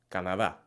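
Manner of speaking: "Canadá" with open ah vowels and soft d; Spanish Canadá. Shown as a demonstration. Teacher repeating the Spanish take. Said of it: "Do you hear the difference?".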